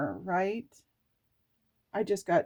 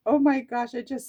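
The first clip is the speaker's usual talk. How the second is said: awed exclamation; 6 semitones higher, 8 dB louder than usual. Real, not acted. Emotional revelation while reading.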